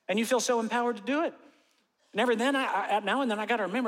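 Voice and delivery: high, fast and worked up. Real, not acted. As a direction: rattle it off, all fired up. Excited rant about comment sections.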